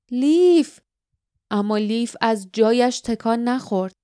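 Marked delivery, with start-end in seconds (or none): 0.1-0.8 urgent call, higher and louder; insistent. A narrator's reading. Barda trying to pull Lif to the door, insistent. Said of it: "با اصرار گفت".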